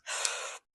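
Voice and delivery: audible breath in before speaking; deep breath. Acted. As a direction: take a bracing breath before the announcement. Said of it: "takes a breath".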